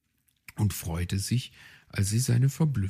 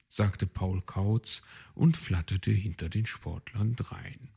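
ordinary delivery, nothing marked

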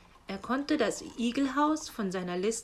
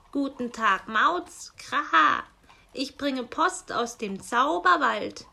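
loud raven voice with harsh caws; croaking raven; 4 semitones higher, 7 dB louder than usual. Acted. Raven postman grumbles to himself, loud and cawing. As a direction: play the grumbling raven squawking Kra.

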